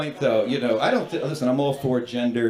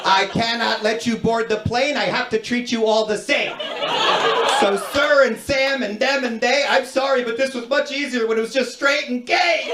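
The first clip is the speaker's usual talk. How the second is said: high, sing-song rhyming recital; exasperated agent breaking into bouncy verse; pitched-up, metrical children's-book cadence; Doctor Seuss rhyme; 7 semitones higher than usual. Retold or imitated. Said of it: "just out of Doctor Seuss's new book".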